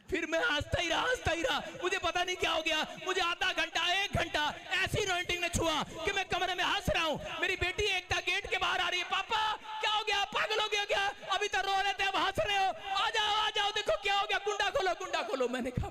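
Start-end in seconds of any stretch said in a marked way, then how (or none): 0.1-7.2 rising preaching. Acted. Preacher building his testimony to a congregation. 7.4-15.5 worried daughter. Retold or imitated. Daughter shouting through the locked door at her father.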